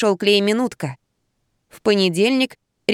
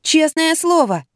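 slow, high-pitched plea to be believed; earnest insistence; 7 semitones higher, slower than usual. A narrator's reading. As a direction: swear it, almost pleading. Patient swears she is truthful. (клятвенно, высоким голосом)

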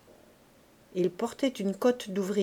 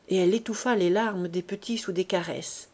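ordinary delivery

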